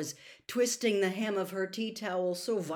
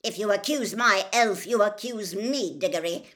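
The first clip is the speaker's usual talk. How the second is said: loud, higher, emphatic angry retort; angry shout; 4 semitones higher, 9 dB louder than usual. A narrator's reading. Mister Crouch defending his elf to Diggory, shouting. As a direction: shout it as a warning. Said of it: "shouted Mister Crouch".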